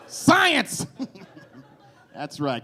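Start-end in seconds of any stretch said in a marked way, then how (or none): 0.1-0.9 high, loud cheer; triumphant exclamation. Acted. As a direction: announce it like a proud discovery.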